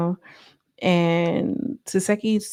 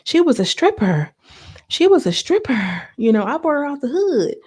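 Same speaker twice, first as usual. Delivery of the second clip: mock tirade, pitch climbing as it goes; retold dragging; 7 semitones higher than usual. Retelling her dragging someone to the sister, mock scornful rant. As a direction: imitate someone dragging a woman, higher and punchy. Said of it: "she started dragging her".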